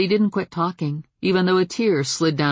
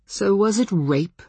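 light; 6 semitones higher than usual. A narrator's reading. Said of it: "Ben asked lightly".

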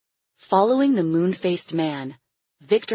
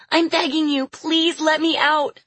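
crying out, begging; 12 semitones higher, 4 dB louder than usual. A narrator's reading. Lily begs departing captor for release, crying out. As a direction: beg desperately in a little girl's high voice. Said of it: "Lily cried".

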